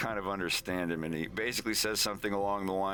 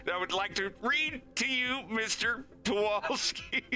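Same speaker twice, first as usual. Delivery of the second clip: very high, squeaky panicked plea; high-pitched terror. Acted. Wolf pleads as Leon presses the knife harder, high-pitched terror. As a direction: squeal it out as a desperate polite request.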